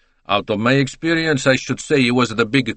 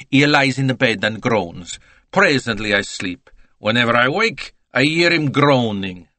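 chatty Italian witness; 2 semitones higher than usual. Acted. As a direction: play the talkative Italian salesman. Italian continues his account.

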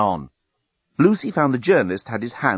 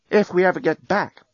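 higher-pitched, doubtful reminder; 7 semitones higher than usual. Acted. Woman doubting to her partner they will return, doubtful. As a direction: raise the voice on a big "if". Doubtful line, echoed lower by Dan.

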